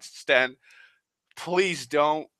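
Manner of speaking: normal talking voice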